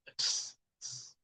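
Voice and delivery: soft, isolated "ks" clusters; voiceless "ks". Shown as a demonstration. Teacher recapping the fourth sound, soft voiceless "ks". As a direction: click a k into a hiss, very softly. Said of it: "El cuarto es el x".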